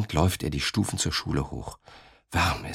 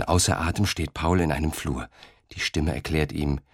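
usual speaking voice

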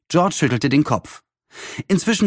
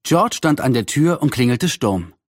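ordinary delivery

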